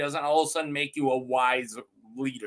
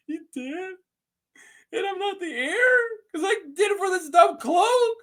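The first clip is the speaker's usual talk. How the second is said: high, wailing hammed-up sob; hammed-up tearful wail, rising to a shout; mock crying; 12 semitones higher than usual. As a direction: cry it out like a grieving knight. Knight learns his father died.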